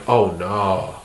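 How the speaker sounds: low, soft sinking dread; quiet dread. Retold or imitated. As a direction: breathe it out as it dawns on you. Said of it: "I'm like".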